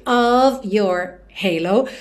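"of your halo" slow, "of" ending in a clear V. Shown as a demonstration. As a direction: stretch "of your halo", V at the end of "of". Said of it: "ele sempre tem som de O V". ("of" com som de V, devagar)

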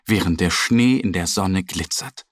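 usual speaking voice

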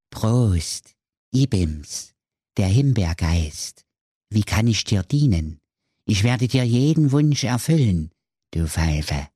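lightly voiced genie, cheekily obliging; raspberry spirit. A narrator's reading. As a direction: offer service as a cheeky spirit in measured phrases. (leicht verstellte Stimme eines frechen Flaschengeists)

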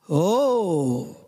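low rumbling man's voice; deep man's grumble. Acted. Stranger in the dark to the shepherd boy, low grumble. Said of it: "brummte eine tiefe Männerstimme".

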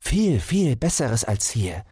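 high, persuasive hare voice. Acted. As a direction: hare talking it up.

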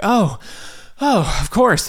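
forced-cheerful answer to the runner, pitched well up; forced-cheerful reply. Retold or imitated. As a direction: act eager, voice jumping up. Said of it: "I was like".

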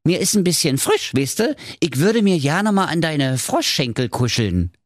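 high wheedling frog; high, cajoling cartoon frog; Frankie der Frosch.

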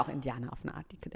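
quick whispered aside; whispering. Acted. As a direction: whisper it conspiratorially.

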